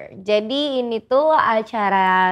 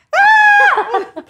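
shrieking burst of laughter; loud squealing laugh, high up; 6 dB louder than usual. Real, not acted. Mengajak teteh bikin video dance, tertawa keras.

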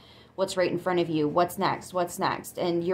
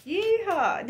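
high, playful cowboy holler; cowboy whoop; 12 semitones higher than usual. Pretend. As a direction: whoop like a rodeo cowgirl. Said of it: "We got a cowboy in here".

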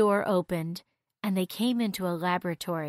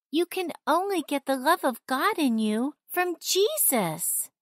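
put-on higher young girl voice; 7 semitones higher than usual. A narrator's reading. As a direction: voice Janet as a girl.

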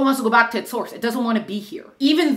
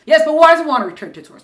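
louder, fast, pushy mock questioner; insistent challenge; 6 semitones higher, 8 dB louder, faster than usual. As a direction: keep pushing the question.

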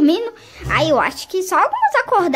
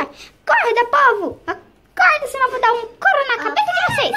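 yelling wake up; 9 semitones higher than usual. Pretend. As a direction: roar them out of bed. Mother yells kids awake.

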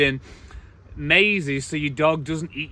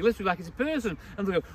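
ordinary talk, nothing marked

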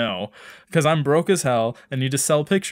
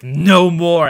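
louder, hammed-up declaration; mock final shout; 3 semitones higher, 10 dB louder than usual. Neighbor deciding to cut the hedge, mock loud cry. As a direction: slam the door on it, theatrically. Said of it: "he's like".

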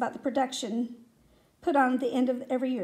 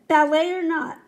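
loud, higher, falling line; raised-voice coach; emphatic echo; 4 semitones higher, 7 dB louder than usual. A narrator's reading. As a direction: call it out forcefully. Coach's echo, louder first take.

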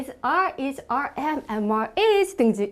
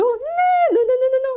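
high, loud "노, 노" refusal; emphatic no; 10 semitones higher, 6 dB louder, slower than usual. Acted. Teacher loudly refusing a wrong idea, high emphatic no.

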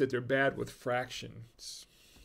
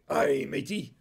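pirate voice. Retold or imitated. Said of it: "That's what the pirate said".

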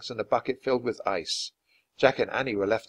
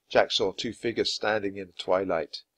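ordinary delivery, nothing marked

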